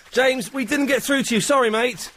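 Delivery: ordinary talk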